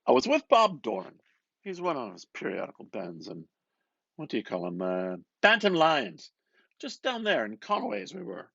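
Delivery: McCoy buttonholing Bloom, loud and eager; McCoy's voice. Acted.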